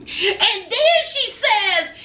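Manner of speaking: usual speaking voice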